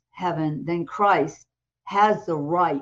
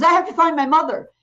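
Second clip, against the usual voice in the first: higher, louder and fast, urgent; 7 semitones higher, 7 dB louder, faster than usual. Retold or imitated. Ian sitting up in the morgue, urgent and loud. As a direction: blurt it out in a rush.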